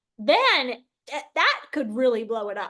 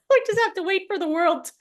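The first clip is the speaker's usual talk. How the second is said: laughing; 7 semitones higher, faster than usual. Real, not acted. Joking about world collapse.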